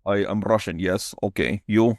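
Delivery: put-on Russian accent in short phrases; terse comic Russian accent. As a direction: play a Russian stranger. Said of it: "I'm, like, Russian".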